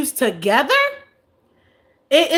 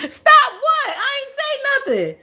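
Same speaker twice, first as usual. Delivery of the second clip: high mock-innocent protest; squeaky fake innocence; 12 semitones higher, slower than usual. Real, not acted. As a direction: play innocent, high pitched. Mock innocent reply to chat.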